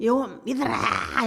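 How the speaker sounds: slow, high mock call with a laugh; hammed-up half-hearted preacher; careless preaching. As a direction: drawl it out lazily while running. Jonah rushing preaching.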